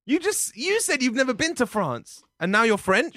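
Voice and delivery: high-pitched disbelief; indignant, amazed challenge; incredulous. Acted. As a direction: catch them out, pitch jumping up. Catches flatmates out in sudden French.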